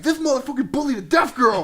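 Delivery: shrieked high and loud; hammed-up outraged scream; accusing shout. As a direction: yell it out to expose him to everyone. Voicing the girl accusing him.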